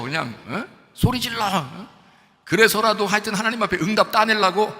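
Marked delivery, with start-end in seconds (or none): 0.9-1.9 mock yelling. Shown as a demonstration. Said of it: "막 고래고래 소리를 질러".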